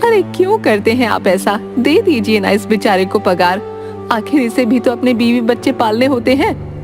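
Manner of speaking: pleading wife. Acted. Wife urging husband to pay the servant, reproachful. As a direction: press the husband on someone else's behalf.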